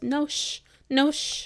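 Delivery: put-on loud, high toddler refusal; imitating defiant child. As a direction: shush back loudly like a stubborn little kid. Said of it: "he goes".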